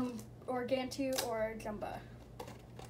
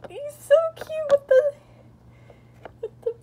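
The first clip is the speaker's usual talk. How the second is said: teary squeal; 13 semitones higher, 11 dB louder than usual. Real, not acted. Gushing over rare figure.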